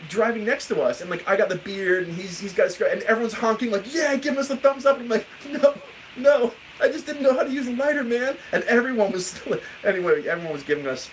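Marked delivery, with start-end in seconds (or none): 3.9-5.0 high-pitched mock cheer; imitated cheering fans. Honking fans cheer from passing cars. 5.5-8.4 high, pleading retold denial; laughing mock protest. Laughing mock plea to cheering fans.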